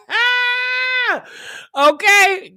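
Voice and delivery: high laughing yelp; cackling ah; laughing shout. Real, not acted. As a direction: burst out laughing. Host bursts out laughing.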